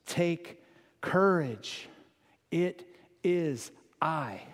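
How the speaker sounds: tender reassurance. Acted. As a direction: gently speak the words. Hear the words of Jesus.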